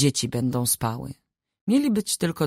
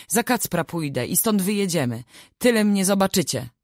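higher-pitched, understated tearful defiance; tearful cry; 6 semitones higher than usual. A narrator's reading. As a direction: call out in mild narrated distress. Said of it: "zawołała, płacząc". (wołanie z lekkim płaczem)

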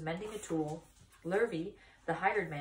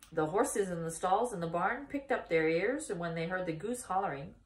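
ordinary delivery, nothing marked

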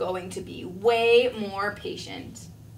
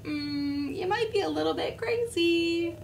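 squirrel voice; 7 semitones higher than usual. Acted. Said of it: "looking at him like".

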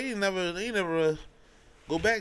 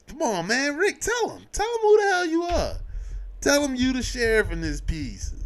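much higher, urgent pleading; pleading to Rick; 9 semitones higher than usual. Retold or imitated. Viewer begging Rick to tell them he's the sheriff. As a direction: beg him to just say it. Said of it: "I was just like".

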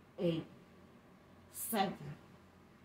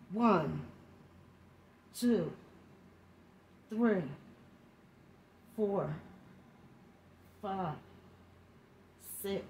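straining mid-workout; 2 semitones lower, 11 dB quieter, slower than usual. Real, not acted. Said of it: "I have a difficult time doing it".

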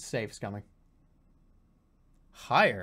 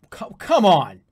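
frustrated outburst; 4 semitones higher, 9 dB louder, slower than usual. Real, not acted. Frustrated at missed shot.

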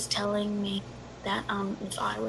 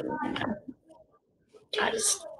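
ordinary talk

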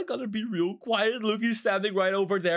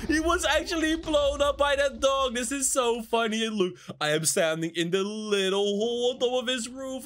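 usual speaking voice

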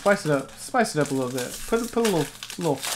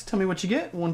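ordinary talk

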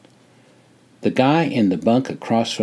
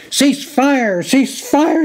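very high, frantic sergeant's yell; screamed order; 4 dB louder, much slower than usual. A narrator's reading. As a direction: scream it to stop a gunner over the roar. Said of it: "he screamed".

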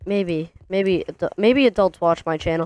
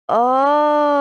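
high, loud "Oh." of discovery; sudden realization; 9 semitones higher, 5 dB louder than usual. Real, not acted. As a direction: yelp of surprise as it clicks. Realizing the puzzle solution.

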